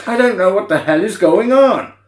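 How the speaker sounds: high, loud bewildered outburst; mock exasperation. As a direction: echo the baffled line, pitched right up. Echoes the bewildered film quote.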